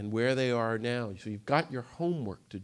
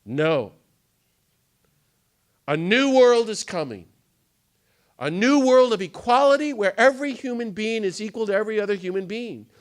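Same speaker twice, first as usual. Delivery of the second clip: louder, higher, ringing declaration; defiant proclamation; 8 semitones higher, 9 dB louder than usual. Retold or imitated. Garrison answering his critics, loud defiant proclamation. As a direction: answer the scoffers with full conviction. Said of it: "And he said".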